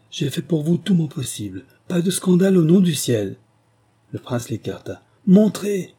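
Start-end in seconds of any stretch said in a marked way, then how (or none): 0.1-3.4 high, rising urgent plea; pleading. A narrator's reading. The marquise begs the prince to avoid a scandal, pleading. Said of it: "adjura-t-elle". 5.3-6.0 high, loud barked order; angry command. A narrator's reading. The prince orders his men again, furious. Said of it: "répéta-t-il".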